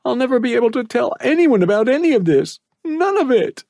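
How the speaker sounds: put-on boy crying as he says goodbye to his mom; tearful farewell. A narrator's reading. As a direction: say goodbye to everyone through tears. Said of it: "He sniffled and groaned".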